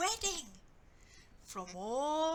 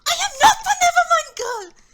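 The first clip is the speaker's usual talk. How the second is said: full shout, far higher and louder; 24 dB louder, faster than usual. Acted. Anna shouting at her mother, throwing her off. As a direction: scream it out and push her away. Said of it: "shouted Anna".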